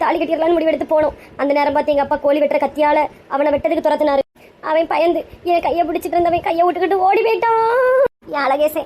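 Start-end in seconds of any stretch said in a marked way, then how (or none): 4.7-8.8 acted weeping lament; crying over a lost lover; tearful wail. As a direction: wail it out, drawing out the last word into sobs. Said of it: "அழாதுங்கம்மா".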